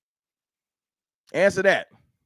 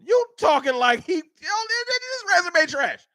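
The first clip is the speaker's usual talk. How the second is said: high, heated outburst that slows down by the end; fired-up streamer yelling at a chatter; real upset; 9 semitones higher than usual. Real, not acted. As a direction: blow up at the chatter's take. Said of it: "I just got upset".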